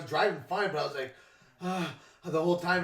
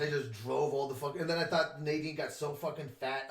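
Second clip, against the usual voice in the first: ordinary talk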